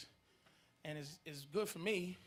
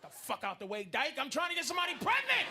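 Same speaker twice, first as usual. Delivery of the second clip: angry sperm shout; 6 semitones higher, 5 dB louder than usual. Acted. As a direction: storm in demanding the egg.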